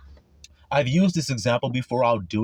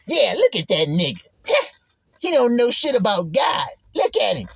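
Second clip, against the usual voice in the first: higher, scoffing put-on voice; mock sneering; 8 semitones higher than usual. Judgmental man mocking another, scoffing and sneering. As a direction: sneer at someone you look down on.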